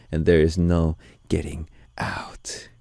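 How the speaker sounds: mock-sinister whisper; creepy joking whisper that there's no escape; spooky whisper. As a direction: whisper it like a playful threat. Joking menace welcoming to family.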